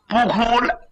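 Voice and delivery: ordinary delivery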